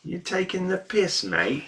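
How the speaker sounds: high-pitched mock British accent. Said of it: "What kind of accent should I do?".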